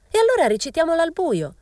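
high, loud shriek. A narrator's reading. A girl shrieks a mocking idea at the group, high and loud. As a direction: shriek it out over the whole room. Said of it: "strillò una ragazza".

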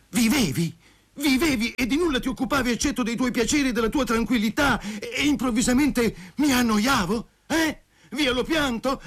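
high-pitched, agitated tirade; indignant rant. A narrator's reading. Jilted lover reproaches ungrateful Masha, shrilly indignant. Betrayed man rants at Masha.

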